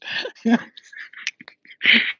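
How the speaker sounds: silent wheezing laugh, high and loud; breathless real laugh. Real, not acted. Vlogger cracking up at her own drug joke, breathless laughter.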